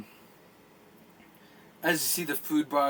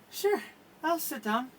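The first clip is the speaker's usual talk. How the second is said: barmaid agreeing; 6 semitones higher than usual. Acted. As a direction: accept shyly and sit down. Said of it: "She says".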